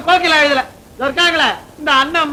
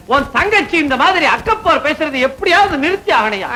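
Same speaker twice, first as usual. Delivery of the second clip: ordinary talk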